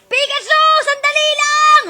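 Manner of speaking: mock shout. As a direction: chase him, yelling. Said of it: "kailangan mo siyang habulin".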